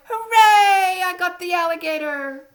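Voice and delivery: high, loud triumphant cheer. Acted. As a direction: cheer like a thrilled child. Boy cheers in the story.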